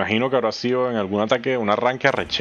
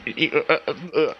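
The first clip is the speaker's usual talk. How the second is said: higher, broken creature grunts; evil Groot grunts; 5 semitones higher, slower than usual. Retold or imitated. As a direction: mimic a monster failing to form the words. (gruñidos imitados de un Groot malo)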